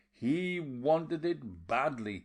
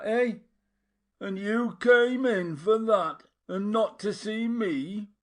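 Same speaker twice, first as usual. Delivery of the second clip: 1 taunting fury; 8 semitones higher, 7 dB louder than usual. A narrator's reading. Mattia taunting while hitting him.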